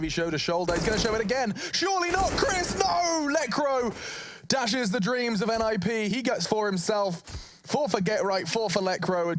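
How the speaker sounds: high-pitched, full-tilt esports commentary; excited play-by-play. Real, not acted. Caster calling Lekr0's four kills to viewers, excited. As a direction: call it at fever pitch, drawing out the climax.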